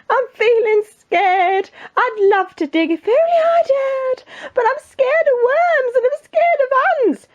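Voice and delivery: very high, drawn-out wail; scared cook. Acted. Cook afraid of fishing for the king, high panicky wail. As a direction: squeal it like a terrified storybook wimp.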